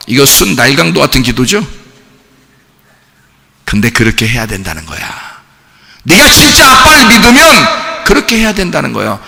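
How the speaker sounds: ordinary delivery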